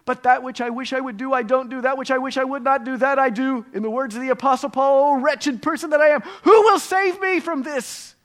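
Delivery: hammed-up panic. Worried listener confessing to the preacher in hammed-up, high panic. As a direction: play a terrified objector wailing at the preacher. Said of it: "What scares me to death".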